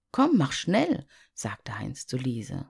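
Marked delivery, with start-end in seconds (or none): 0.1-1.1 put-on eager boy. Heinz drängt seine Schwester.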